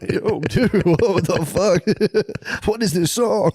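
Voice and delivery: high and giggling through it; laughing mock shock. Man retelling his wife's move, laughing and high. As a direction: act amazed while cracking up.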